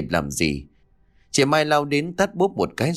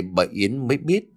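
ordinary delivery, nothing marked

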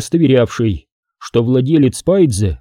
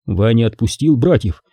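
ordinary delivery

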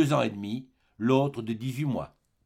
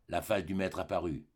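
ordinary delivery

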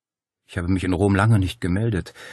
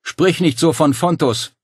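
shouted reproach; 8 semitones higher, 6 dB louder than usual. A narrator's reading. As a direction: plead loudly across the gap. Dario shouting back at Claudia.

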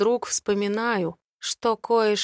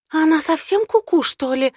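put-on higher, louder girl's voice; shocked blonde friend, higher pitch; Mel's voice; 8 semitones higher, 6 dB louder than usual. Mel voiced, shocked at diary.

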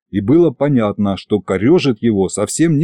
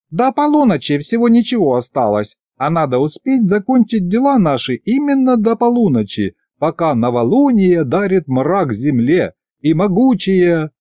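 high goddess voice; 7 semitones higher than usual. Acted. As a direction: proclaim it from above.

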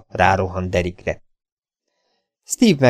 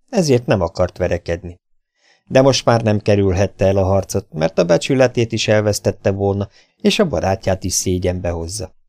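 ordinary delivery

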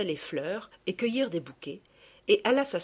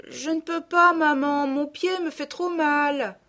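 high, loud, drawn-out plaint; hurt girl's wailing excuse; whining injured daughter; 8 semitones higher, 6 dB louder than usual. A narrator's reading. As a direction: call back pitifully to your mother. Injured girl calls back in pain.

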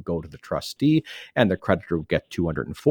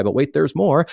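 higher, louder pitchman hype; infomercial guy; 7 semitones higher, 5 dB louder than usual. Pretend. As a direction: sell it like a late-night TV ad. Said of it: "I almost sound like an infomercial guy".

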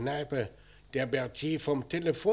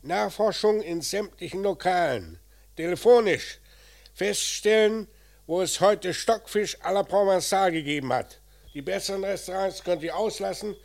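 raised, louder voice projecting down the line; loud phone orders; 5 semitones higher, 7 dB louder than usual. Acted. Inspector orders his men over the phone, loud and firm. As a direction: bark instructions into the receiver.

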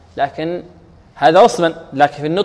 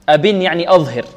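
ordinary talk

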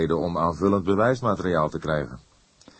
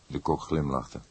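ordinary delivery, nothing marked

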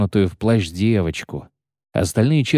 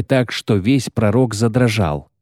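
usual speaking voice

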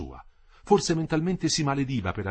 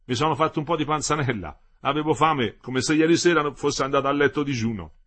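a slightly raised, lively character voice; lightly voiced husband, louder than narration; Metello at breakfast; 4 semitones higher, 6 dB louder than usual. A narrator's reading. Husband explains his breakfast to his wife, lightly voiced and lively.

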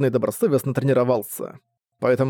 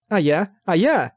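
cute little voice; 5 semitones higher, slower than usual. Acted. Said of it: "милым голоском вымолвила".

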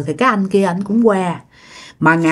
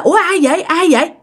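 high, anxious repeated question; asking fearfully who is there; startled plea; scared question; 7 semitones higher, faster than usual. Retold or imitated. Frightened question in the dark.

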